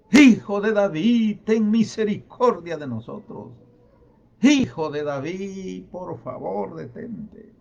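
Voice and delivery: beseeching shout that drops off at the end; crying out loudly. Acted. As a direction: cry out for mercy, stretching each call. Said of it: "Más fuerte gritaban".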